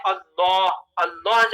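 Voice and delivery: اللہ with thick, dark L, "Allaw"; heavy laam. Shown as a demonstration. Teacher showing heavy laam, اللہ said thick and rounded. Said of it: "موٹا کر کے پڑھا جاتا ہے".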